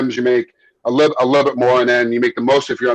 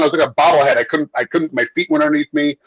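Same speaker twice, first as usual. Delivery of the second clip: usual speaking voice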